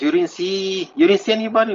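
spooked coworker pressing the narrator, alarmed; retold alarm. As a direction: imitate his shaken disbelief. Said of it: "He goes".

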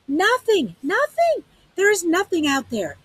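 high, loud anguished shout. A narrator's reading. Sag crying out to Lena that nothing is there. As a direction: cry it out, wild-eyed. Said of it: "His voice became a shout".

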